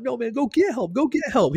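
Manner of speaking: high, strained mock yell; exasperated urging. Injured jumper to the panicking cadet, exasperated urging. Repeats order over cadet's screams.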